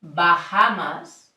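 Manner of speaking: Bahamas said slowly with open a sounds; English word Bahamas. Shown as a demonstration. Teacher showing the A sound in Bahamas. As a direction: stretch out Bahamas to show the a. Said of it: "when you say in English".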